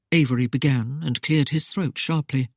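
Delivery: usual speaking voice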